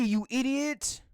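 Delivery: ordinary talk, nothing marked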